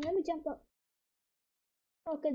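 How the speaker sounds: usual speaking voice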